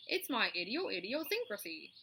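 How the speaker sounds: stumbling honky goose voice; gander's excuse. Acted. The gander explains himself to Charlotte, stumbling and honky. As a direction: trip over the big word proudly. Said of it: "replied the gander".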